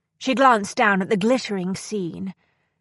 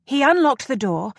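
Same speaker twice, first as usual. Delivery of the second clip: ordinary delivery, nothing marked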